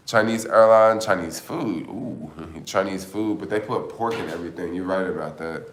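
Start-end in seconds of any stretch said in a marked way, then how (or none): none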